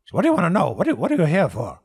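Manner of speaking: loud high innkeeper voice; innkeeper asking. Acted. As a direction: press them on why they came. Tavern keeper questions the party.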